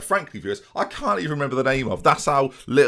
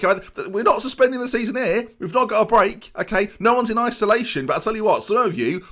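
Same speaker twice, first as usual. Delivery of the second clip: angry rant; 10 semitones higher than usual. Real, not acted. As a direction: tear into the players. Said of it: "ranting at players".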